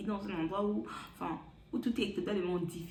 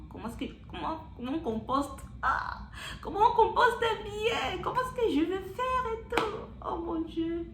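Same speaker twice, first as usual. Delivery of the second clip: a frantic mock prayer, slowing down; panicked plea; 12 semitones higher, 3 dB louder than usual. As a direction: voice jumps high, half laughing, half crying. Panicking about composting ticket.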